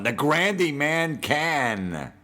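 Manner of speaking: high, slow, drawn-out home run call; mock radio home run call; announcer catchphrase. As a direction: stretch each word like a corny broadcaster. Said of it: "Whenever he hits a home run".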